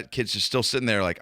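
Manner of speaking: ordinary delivery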